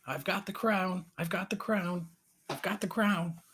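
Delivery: high-pitched gloating chant, no real volume; lightly faked yell in a high character voice; mock yell. A narrator's reading. Raven flying away with the crown, high mock yell.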